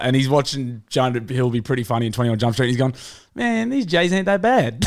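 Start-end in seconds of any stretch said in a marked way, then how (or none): 3.3-4.8 high-pitched, slow mock drawl. Co-host imagining the stoned viewer's reaction, slow high mock voice. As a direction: imitate someone stoned, drawn out and pitched up. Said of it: "He's obviously high as a kite".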